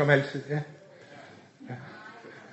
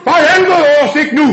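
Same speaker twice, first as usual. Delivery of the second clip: higher, louder curse; alarmed shout; 7 semitones higher, 6 dB louder than usual. Acted. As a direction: yell in panic at the cop. Caught by the police.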